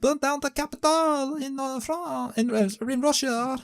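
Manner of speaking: very high mock French, sliding into German; badly faked French accent that drifts towards German, squeaky high; botched French accent. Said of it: "That became kinda German".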